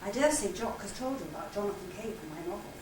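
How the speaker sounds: usual speaking voice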